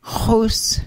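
"hus." with a throaty rasp and rounded lips; "hus." begun with a voiceless throat rasp on rounded lips; rounded guttural h. Shown as a demonstration. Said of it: "guttural H with rounded lips".

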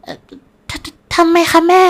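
high, slow, stuttering question; shocked stammer. Acted. Shocked daughter asking her mother why, stammering. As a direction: stumble over the first word.

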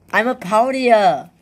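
loud, high party cheer; party shout. Acted. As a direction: whoop it up like a partier. Charades, acting a partier.

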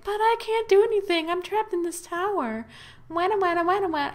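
high, whining princess voice; mock-wail like a trapped princess; whiny damsel. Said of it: "she's all like".

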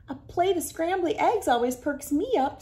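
lightly voiced farm lady; cheerful farm girl. Voicing Missy May.

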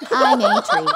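high, loud robotic tree voice; Stephen Hawking as a tree. Acted. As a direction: squeak it out in a stiff robot voice. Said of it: "do a Stephen Hawking impression of a tree".

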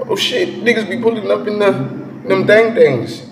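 high-pitched, excited outburst; hyped reaction. Real, not acted. Hyped reaction to the music video.

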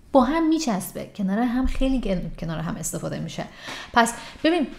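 ordinary delivery

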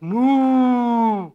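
slow, long drawn moo; mooing cow. Acted. Storyteller mooing slowly like the Jersey cow. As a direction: draw it out like a cow.

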